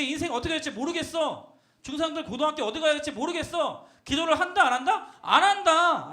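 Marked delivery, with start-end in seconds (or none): 5.2-6.1 loud, drawn-out answer; emphatic preacher's shout; loud refrain. Shown as a demonstration. Loud refrain answering own question.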